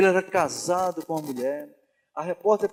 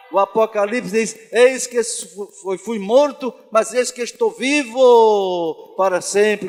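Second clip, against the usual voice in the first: loud, raised declamation with stretched key words; thundering sermon climax, easing on the closing praise; full-force preaching; 2 semitones higher, 8 dB louder, slower than usual. Real, not acted. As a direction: proclaim scripture triumphantly at the top of your voice.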